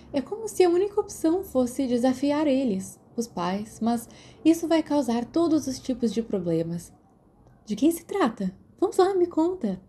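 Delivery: a hint of an eager friend pressing for a secret. Narrator voices Lily coaxing.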